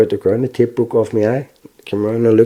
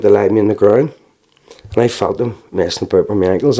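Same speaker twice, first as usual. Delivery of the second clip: ordinary talk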